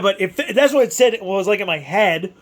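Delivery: normal talking voice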